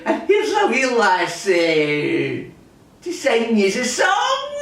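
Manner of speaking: usual speaking voice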